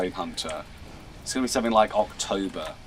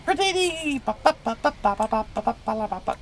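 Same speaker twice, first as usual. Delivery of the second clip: high Jawa chatter; 13 semitones higher than usual. Pretend.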